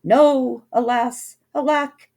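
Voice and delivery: loud, high hammed-up lament; hammed-up loud prince's wail; anguished cry. A narrator's reading. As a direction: wail it like a tragic hero. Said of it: "The prince cried".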